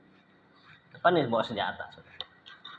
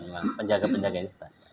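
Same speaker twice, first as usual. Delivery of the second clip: ordinary talk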